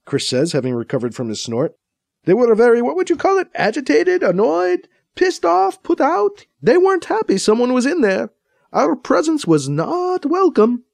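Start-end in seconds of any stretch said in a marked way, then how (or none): 2.2-10.8 excited. A narrator's reading. Agitated retelling.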